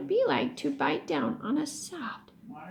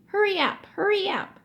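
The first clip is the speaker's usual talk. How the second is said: high mocking nag; mocking high-pitched hurry up; 7 semitones higher, 3 dB louder than usual. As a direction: mimic the pushy friend, higher. Billy mimics Tom rushing him.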